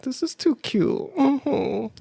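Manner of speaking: high, cutesy coo; smitten cooing. Retold or imitated. He's all smiles and giggles, smitten high cooing. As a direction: coo it like a delighted admirer. Smitten reaction to smiling date.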